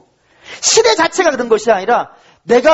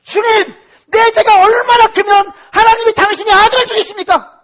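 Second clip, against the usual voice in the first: high, raised-voice cry to God; anguished lament; 8 semitones higher, 4 dB louder than usual. Acted. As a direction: act out a desperate outcry of remorse. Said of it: "땅을 치면서 탄식하고 있어야 돼요". (높은 목소리로 부르짖는 탄식)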